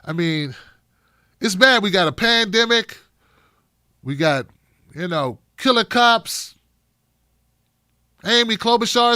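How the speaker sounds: ordinary delivery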